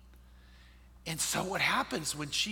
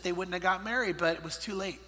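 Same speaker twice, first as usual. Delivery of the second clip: ordinary delivery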